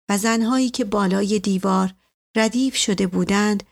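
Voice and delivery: ordinary delivery, nothing marked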